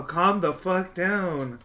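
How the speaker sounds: drawn out, each word hit slowly; slow it right down to make the point; slow emphatic. Real, not acted. Telling Disney adult off.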